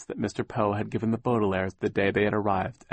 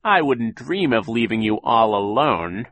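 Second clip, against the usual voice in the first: slow, louder, menacing villain; dark voice; 7 dB louder than usual. A narrator's reading. Sham threatening Violet, dark slow menace. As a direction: loom over her, slow and dark. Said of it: "he said in a dark voice".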